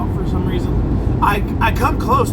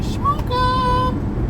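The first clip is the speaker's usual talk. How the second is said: silly high voice. Acted.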